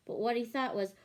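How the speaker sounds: ordinary delivery, nothing marked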